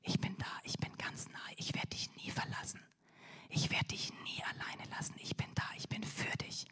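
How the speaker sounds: very soft whisper right at the ear; Jesus comforting a lonely listener in a tender whisper. Acted. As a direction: whisper reassurance close to the ear. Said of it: "er flüstert".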